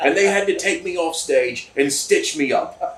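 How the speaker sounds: put-on a loose British accent, chuckling through the tale. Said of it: "It's British, okay?".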